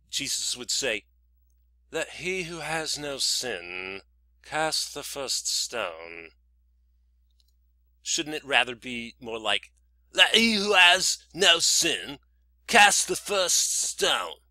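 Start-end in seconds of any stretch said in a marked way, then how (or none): 1.9-6.3 slow, measured proper English accent. Shown as a demonstration. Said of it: "proper English accents". 10.1-14.4 loud, high, drawn-out Scouse; Scouse accent. Shown as a demonstration. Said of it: "shouldn't you use Scouse accents".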